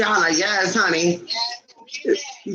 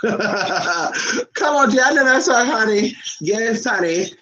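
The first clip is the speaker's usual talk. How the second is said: ordinary talk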